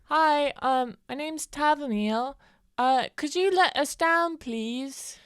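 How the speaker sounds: high, slow polite character voice; sweet high introduction. Acted. Tavamil politely asks the men to let them down. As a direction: ask nicely in a high sweet voice.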